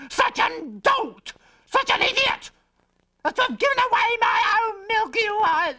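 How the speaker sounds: shrieking, very high and slow, near tears; furious mother. Acted. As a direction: scold the foolish son in despair.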